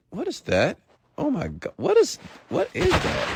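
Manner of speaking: high, soft, puzzled questioning; startled confusion. Real, not acted. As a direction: caught off guard, pitch jumping up. Reacting to something unexpected mid-story.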